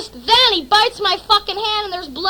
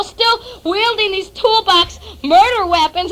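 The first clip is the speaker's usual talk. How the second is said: usual speaking voice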